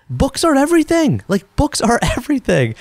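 playful Indian accent impression, bursting with enthusiasm. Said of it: "Forgive my Indian accent".

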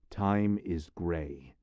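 very slow, weighty words. Acted. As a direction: let each word hang. Slow grim pronouncement.

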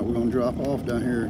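ordinary talk, nothing marked

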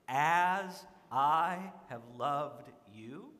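each word slowed and set apart; slow, weighty quote. Shown as a demonstration. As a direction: let the words land slowly. Quoting Jesus, slowed.

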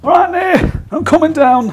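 loud, high call down the stairs. Real, not acted. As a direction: shout down to a mate. Calling down the stairs.

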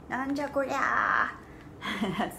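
hammed-up what on earth is this; mock astonishment. Teacher mock-shocked at the muscular drawing, hammed-up.

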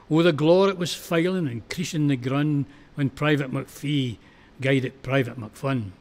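ordinary delivery, nothing marked

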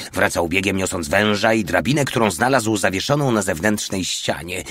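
usual speaking voice

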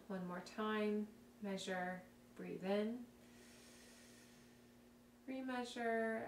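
soft, calm guided breathing cues; calm guided exercise voice. Acted. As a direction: talk them through it gently and quietly.

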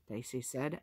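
usual speaking voice

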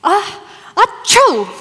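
big drawn-out theatrical sneeze; slow, hammed-up "achoo"; fake sneeze. Speaker opens with a sneeze.

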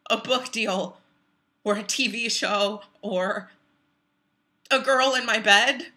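loud, halting protest, building in force; anguished outrage. Acted. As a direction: let the buried anger burst out, pausing between blows. Outburst over mother's murder.

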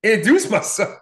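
high, loud laughing what-the-hell; incredulous laughing outburst; laughing disbelief. Retold or imitated. Reacting to mom's request.

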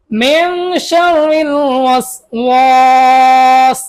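long drawn-out chanted syllables; slow recital. Shown as a demonstration. Teacher chants মিং শারিল ওয়াসওয়াস slowly for pupils. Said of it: "তারপর আমার সাথে বলুন".

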